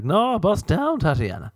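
mock marketing man; pitchy bus-down pitch. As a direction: sell it like a goofy jingle. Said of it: "And I'd be like".

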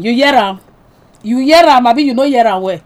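ordinary delivery

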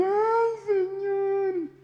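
high, loud hammed-up cry to the Lord; mock wailing. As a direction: imitate churchgoers bawling out in worship. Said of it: "se ponen a llorar por todo".

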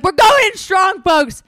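put-on shouted high and loud, pumping up the girls; hyped-up rally cry. Heading into the party with friends, a loud high hyped shout. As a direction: yell it like a battle cry before the party. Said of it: "But I'm like".

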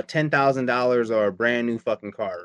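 ordinary talk

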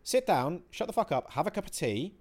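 mock shouting down your own frustration; berating yourself. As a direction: tell yourself off like a mate would. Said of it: "start shouting at myself and berating myself".

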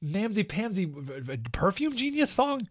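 high, sputtering scorn; mock disgusted disbelief. Viewer scorning a truck commercial's song, mock disbelief. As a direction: sputter through the insults, pitch jumping up.

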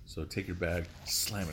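ordinary delivery

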